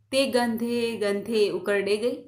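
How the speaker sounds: ordinary delivery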